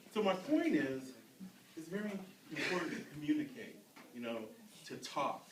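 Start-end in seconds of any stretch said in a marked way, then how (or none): none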